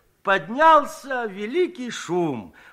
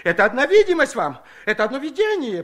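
sly evasion; 7 semitones higher, 5 dB louder, faster than usual. Acted. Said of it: "пробовал схитрить Щукарь".

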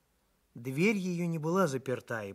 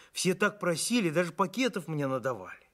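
higher, louder, lively insistence; exclaiming; 6 semitones higher, 4 dB louder than usual. A narrator's reading. Doctor backs up his praise to baker, exclaiming. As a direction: insist eagerly, voice lifted. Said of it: "воскликнул я".

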